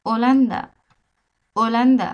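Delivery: ওলান্দা, h silent, said firmly; a model ওলান্দা for learners; Spanish pronunciation. Shown as a demonstration. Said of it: "এইখানে একটা উচ্চারণ দিছি".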